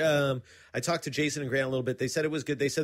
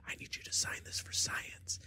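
hushed, unvoiced whisper imitating a little girl; creepy child whisper; 10 dB quieter than usual. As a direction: whisper it like an eerie ghostly kid. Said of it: "whispery, demonic voice".

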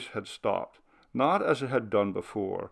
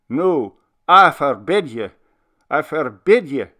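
put-on loud, much higher remonstrating old minister; expostulating; 6 semitones higher, 9 dB louder than usual. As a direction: scold the faint-hearted spirit, hurt and indignant. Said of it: "His voice took on a tone of expostulation".

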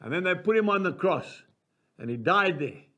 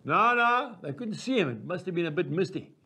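normal talking voice

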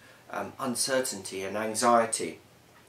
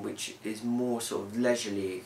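ordinary talk

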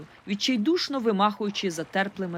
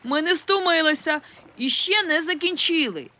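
high, loud stubborn yell; girl shouting at the rescuers; defiant shout; 8 semitones higher, 4 dB louder than usual. A narrator's reading. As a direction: shout back, refusing help. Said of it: "кричала я у відповідь".

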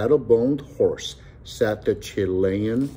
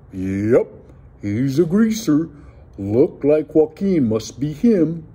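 higher, louder, drawn-out oaf voice; oaf in the crowd; 6 semitones higher, 4 dB louder, slower than usual. A narrator's reading. Oaf in the crowd identifying the bandit, loutish declaration. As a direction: declare it like a slow-witted lout. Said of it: "An oaf in the crowd".

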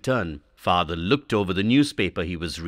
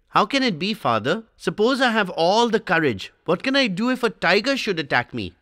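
lightly voiced boy, higher, doubting his father; a small boy arguing the tiger story with his father, higher voice; Swami disputing; 9 semitones higher than usual. A narrator's reading. Boy questioning father about the tiger, lightly higher child voice.

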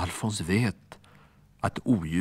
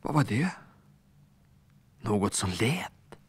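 whispered startled question; listen in the dark, afraid; hushed alarm; 2 semitones higher than usual. A narrator's reading. Alfons hearing a noise in the dark, whispered and uneasy.

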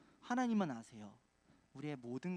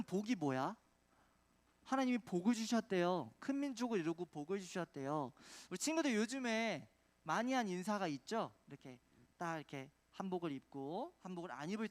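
ordinary delivery

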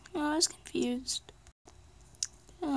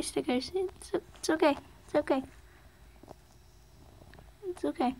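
normal talking voice

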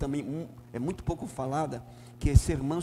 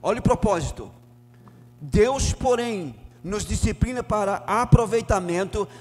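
loud, ringing scripture reading from the pulpit; full-force preaching; 5 semitones higher, 8 dB louder than usual. Acted. Pastor reading Hebrews to the church, loud fervent preaching. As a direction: proclaim every verse to the back row.

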